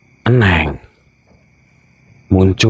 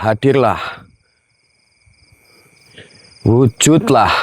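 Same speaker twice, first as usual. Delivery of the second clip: raised, slow ritual call to the spirit; summoning incantation; 5 semitones higher than usual. Acted. Ghost hunter summoning the spirit at the ride, ritual call. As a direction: call the unseen thing to show itself.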